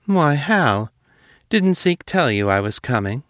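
a feigned, innocent question that rises high at the start; mock surprise. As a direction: play innocent to needle him. Julia feigns surprise to taunt Hal.

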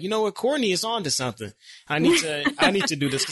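lively retold lightbulb moment; excited realization. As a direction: act out suddenly deciding to jump in. Acting out his sudden realization.

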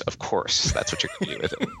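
laughing through a teasing remark. Real, not acted. Host teasing the guest about arm servers, laughing. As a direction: tease him while cracking up.

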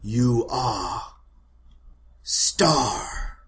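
slow, halting, solemn pronouncement; village matriarch. Acted. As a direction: pause grandly between phrases. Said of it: "and then she says".

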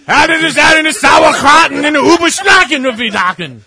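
shouted mock German, cracking up; fake German gibberish breaking into laughter. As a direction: rant nonsense German until the laugh wins. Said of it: "that's exactly what it would sound like".

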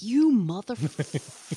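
drawn-out muted curse; pissed off. Retold or imitated. Mom whose son took off running, retold pissed-off curse. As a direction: stretch the curse in exasperation. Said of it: "I was so pissed".

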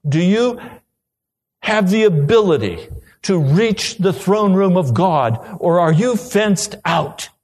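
pleading sermon climax; preaching. Acted. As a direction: press the congregation with building urgency. Preaching at full force.

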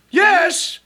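very high-pitched, hammed-up reply; comic, squeaky "present" response; mock roll-call answer. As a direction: answer a summons with exaggerated obedience.

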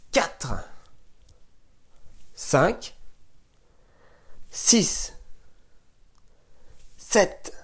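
compte à voix soufflée, en plein effort; essoufflé. Real, not acted. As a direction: souffle court pendant les squats. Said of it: "le cardio commence à monter". (compte essoufflé en faisant des squats)

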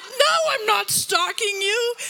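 high, loud, drawn-out protest; freaked-out retort. Retold or imitated. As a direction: shout it back, re-enacting your panic. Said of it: "I'm, like, freaked out".

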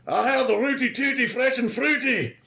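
high mock Scottish order; hammed-up Scottish brogue; Billy Connolly. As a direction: order pancakes like a Scottish warrior. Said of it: "have you been watching Brave".

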